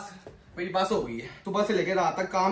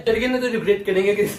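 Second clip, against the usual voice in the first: mocking imitation; 3 dB louder than usual. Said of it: "मेरी नकल मत उतारना".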